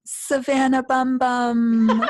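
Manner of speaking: high, slow, laughing singsong; baby-voiced blurt. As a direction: blurt the wrong name in a sweet baby voice. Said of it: "she forgot Scarlett's name. And then she went".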